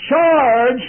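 very loud, high battle shout; lieutenant's charge cry. Retold or imitated. Lieutenant leading troops into battle, full shout. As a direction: roar the order to charge.

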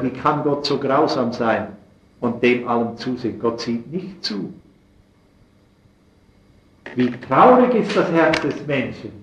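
ordinary talk

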